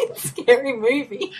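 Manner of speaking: high, squeaky through real laughter; real teary laughing fit; laughing to tears. Real, not acted. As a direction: barely get it out for laughing. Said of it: "I'm, like, crying 'cause I'm, like, laughing".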